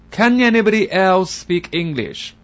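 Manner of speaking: exasperated shout. Acted. Foreigner shouting for someone who speaks English. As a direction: plead loudly for help. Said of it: "于是大喊".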